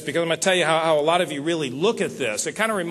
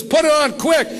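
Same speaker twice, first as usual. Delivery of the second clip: urgent order; 9 semitones higher, 6 dB louder than usual. Retold or imitated. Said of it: "He says".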